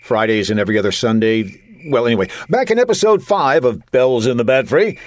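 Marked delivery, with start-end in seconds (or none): none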